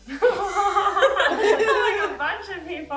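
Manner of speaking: talking through giggles; words broken by laughter; laughing. Real, not acted. Laughing while telling anecdote.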